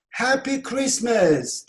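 lively demonstrated exclamation; exclaimed holiday greeting. Shown as a demonstration. Teacher shows shouting a New Year greeting, exclaimed. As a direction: call out a festive greeting. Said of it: "diye bağırıyorsunuz yılbaşında".

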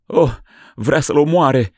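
loud, high, despairing cry; anguished cry. Acted. Mother crying out for her son. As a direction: cry out clutching her child. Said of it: "răgni duioasa mumă".

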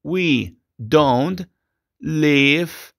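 slow, clear sentence for repetition; slow English teacher model. Shown as a demonstration. Teacher dictates the model sentence to students. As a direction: articulate each word for the class.

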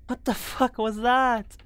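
high, loud frightened questions; startled alarm. Real, not acted. Streamer to chat, loud startled fright. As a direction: yelp it out, spooked. Scary sound startles her.

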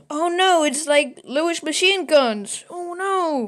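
high, loud alarmed gasp of Oh no; alarmed discovery. Retold or imitated. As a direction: sound alarmed at finding the guns. Tintin discovers machine guns.

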